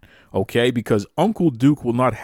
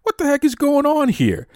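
much higher, mock outrage; mock shocked outburst; 14 semitones higher than usual. Host reacting to a friend's cheeky birthday shoutout, mock shocked. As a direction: play up the disbelief, half laughing.

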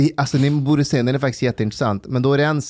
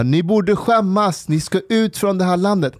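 retold shout; 9 semitones higher than usual. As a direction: play Paludan yelling down the angry onlookers. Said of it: "då skriker han mot dem".

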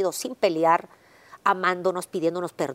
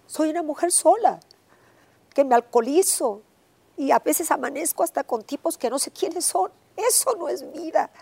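reproaching God; 8 semitones higher than usual. Retold or imitated. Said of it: "le reclamé a Dios gritándole".

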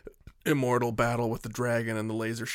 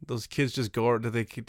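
normal talking voice

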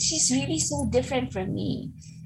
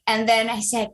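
usual speaking voice

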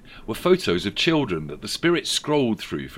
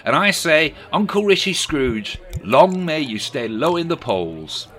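raised, louder politician voice; Keir Starmer impression; 5 semitones higher, 6 dB louder than usual. A narrator's reading. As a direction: play Keir telling a story. Sir Keir toasting.

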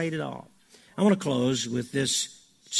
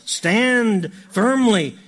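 loud, high, slowly drawn-out command; emphatic exhortation; 7 semitones higher, 7 dB louder, much slower than usual. Real, not acted. Preacher urging the church against winds of trouble, emphatic. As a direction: ring out each word slowly and forcefully.

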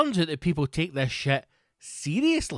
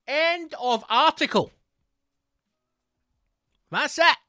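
incredulous; 11 semitones higher than usual. Real, not acted. Mocking the short article.

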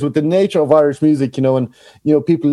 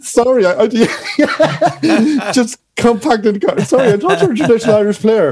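acted complaint, much higher, breaking into laughter; laughing mock indignation; 9 semitones higher than usual. Guest acting out asking for the piano, laughing. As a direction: play the baffled musician while laughing. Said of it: "And you're like".

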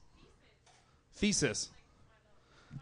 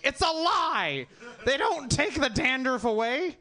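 mock commercial outcry; 6 semitones higher, slower than usual.

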